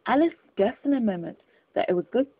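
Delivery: plain reading voice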